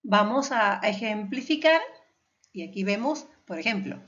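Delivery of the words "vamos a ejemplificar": ordinary delivery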